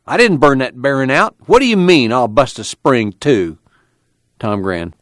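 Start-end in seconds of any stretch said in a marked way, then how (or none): 0.1-3.6 higher-pitched, indignant retort; angry protest. A narrator's reading. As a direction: throw the accusation back angrily. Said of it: "went red with anger".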